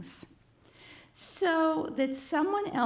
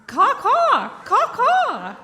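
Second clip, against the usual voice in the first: high cawing crow, much louder, with a laugh; 8 semitones higher, 11 dB louder, slower than usual. Acted. Crow taunting Harold while the class guesses, loud laughing caws. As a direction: belt out the caws like a gloating crow. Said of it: "the crow just replies".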